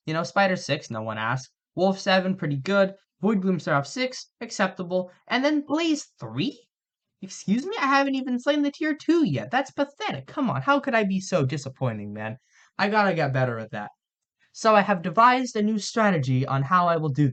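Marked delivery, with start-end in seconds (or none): 5.3-12.1 high-pitched, incredulous mock outrage; mock disbelief. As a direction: act appalled, pitch shooting up. Mock outrage at own low level.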